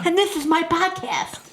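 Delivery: high, slow, chirpy host intro; sing-song kids' TV intro; mock host intro. Mocking a sister's cheesy podcast intro, high and slow. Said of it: "you always say it in the tone of".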